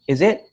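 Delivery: IT lifted high as a question; rising question intonation. Shown as a demonstration. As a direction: ask it, pitch climbing on IT. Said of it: "my pitch got higher".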